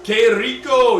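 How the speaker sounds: put-on loud, bright Spanish accent exclamation; playful Spanish accent cheer. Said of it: "with a Spanish accent".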